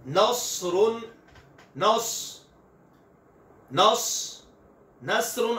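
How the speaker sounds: Arabic saad, thick and whistling; heavy whistled saad. Shown as a demonstration. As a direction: say नसरुन with a full, heavy saad. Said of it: "सौद की आवाज मोटी और सीटी वाली".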